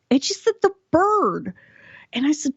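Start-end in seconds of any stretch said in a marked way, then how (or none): 0.6-1.5 much higher, matter-of-fact mom; lightly voiced mother; imitating mom. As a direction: say it like it's obvious, as mom. Mother answering, obvious to her.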